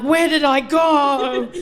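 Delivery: slow, laughing and half-crying; mock despair at losing herself; laughing lament, high; laughing dismay. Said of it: "I'm like".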